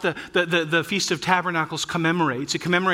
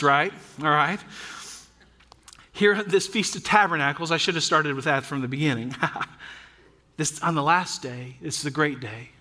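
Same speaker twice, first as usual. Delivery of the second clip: ordinary talk, nothing marked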